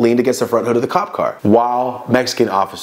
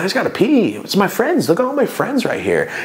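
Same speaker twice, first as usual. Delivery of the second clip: high, innocent pleading to the cop; playing innocent; 8 semitones higher than usual. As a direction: act harmless and high-pitched for the cop. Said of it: "trying to play it to the cop like".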